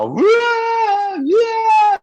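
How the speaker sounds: high, loud mock wail; tangisan histeris tiruan. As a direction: imitate kids bawling at full volume. Imitates retreat children wailing. (tiruan tangis meraung, melengking dan keras)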